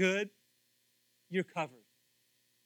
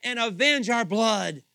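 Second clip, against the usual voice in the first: high, full-force cry, easing toward the end; loud calling out; 5 semitones higher, 12 dB louder than usual. Acted. Slain souls under the altar appealing to the Lord, calling out loudly. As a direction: call up to the Lord at top volume. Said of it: "They called out in a loud voice".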